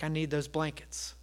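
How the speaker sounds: low, very soft voice; hushed acted reply; quiet reluctant aside. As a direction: drop to a small, low murmur.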